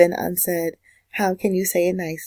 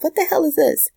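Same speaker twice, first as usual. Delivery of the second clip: mock alarm; 9 semitones higher than usual. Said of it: "you might be like".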